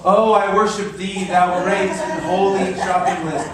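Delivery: hammed-up worship of a shopping list; mock worship.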